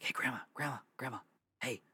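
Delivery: soft, insistent call to a sleeper; hushed waking call. Retold or imitated. Grandson waking grandma at two in the morning, hushed call. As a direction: imitate gently rousing someone from sleep.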